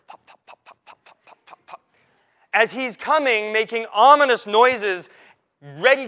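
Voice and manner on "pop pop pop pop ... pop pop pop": soft unvoiced popping of webbed feet; whispered pop pop. Preacher imitating the duck's sneaking feet with whispered pops. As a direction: breathe each pop out without voice, like sneaking duck feet. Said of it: "And you could hear pop".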